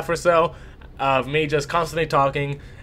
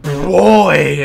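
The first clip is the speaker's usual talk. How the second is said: put-on a sudden, much louder shout of boy; shouted call; 11 dB louder than usual. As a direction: yell it across the room. Friend shouts his name again.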